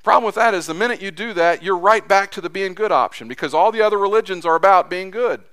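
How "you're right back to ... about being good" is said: normal talking voice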